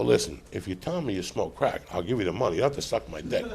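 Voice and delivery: low, soft and hushed, like a secret deal; hushed bargaining. Retold or imitated. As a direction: lean in and talk her down quietly. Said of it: "And I go".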